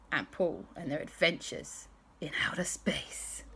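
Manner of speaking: soft fading whisper; bedtime hush. A narrator's reading. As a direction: wind down the story gently.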